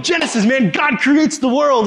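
high, eager retold preaching; excited storytelling. Evangelist telling a lady of creation, excitedly. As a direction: stretch it out with eager wonder. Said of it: "I'm so excited about sharing the gospel".

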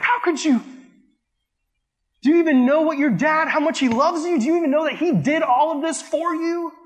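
high, pleading disbelief at an ungrateful son; indignant rebuke. Retold or imitated. Thinking of the son bashing his dad, indignant high reproach. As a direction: raise your pitch high in shocked reproach.

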